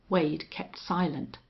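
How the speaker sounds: usual speaking voice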